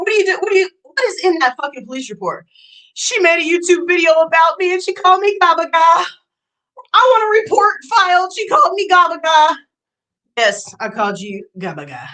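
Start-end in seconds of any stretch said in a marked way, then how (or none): 2.9-9.6 high, loud mock complaint; mocking whine. Streamer mocking the rival's police report demand, loud mock whine. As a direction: mimic her outrage, hammed up.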